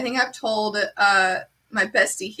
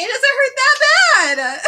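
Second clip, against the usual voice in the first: high, slow, sarcastic mock bravado; mock toughness; 14 semitones higher, 5 dB louder, slower than usual. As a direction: play it as a sarcastic imitation, stretched out. Mocking people who downplay pain.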